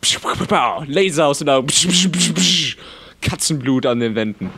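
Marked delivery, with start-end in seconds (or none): 0.0-2.8 laser zap noise. Pretend. Laser eyes sound effect.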